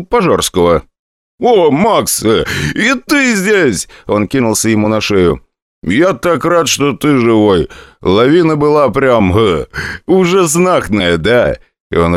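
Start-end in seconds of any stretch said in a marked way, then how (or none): 1.4-3.9 put-on much higher, slow drunken cheer; delighted drunk spotting a friend. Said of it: "пьяным голосом". 5.8-11.6 put-on high, slow drunken babble; rambling drunk, warmly giddy. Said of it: "пьяным голосом".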